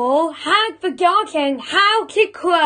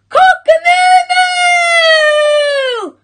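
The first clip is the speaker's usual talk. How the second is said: very high, loud, long-drawn rooster alarm; alarm shout; 14 semitones higher, 6 dB louder, slower than usual. A narrator's reading. Cockerel raising the alarm about a fox, high shout. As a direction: shriek the crow as an alarm. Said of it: "shouted Cockerel".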